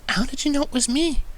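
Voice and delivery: very high, startled question. A narrator's reading. Fluttershy startled he recognised her, high and surprised. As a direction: caught off guard, amazed. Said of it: "standing startled".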